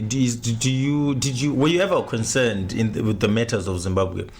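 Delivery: ordinary talk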